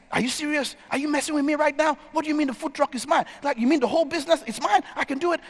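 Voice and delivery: high, rushed, incredulous retelling; overwhelmed disbelief. Retold or imitated. Alex learns the food truck is his, overwhelmed. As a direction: imitate someone overwhelmed by sudden good news. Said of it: "Alex breaks down crying".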